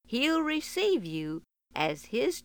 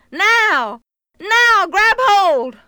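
loud, slow high call; 11 semitones higher, 13 dB louder, much slower than usual. Acted. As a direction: call down, drawn out. Cowboy calls down.

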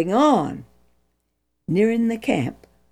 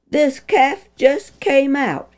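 higher, scornful echo of the excuse; mocking quote; 7 semitones higher than usual. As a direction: repeat his lame excuse with scorn. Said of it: "he said".

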